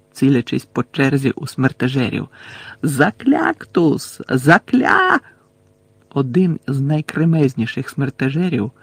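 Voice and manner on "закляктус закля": high, stretched incantation, cut short; shouted spell. A narrator's reading. Neville casting until he is grabbed, high drawn-out cry. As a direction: cry it higher the second time. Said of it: "закричав Невіл".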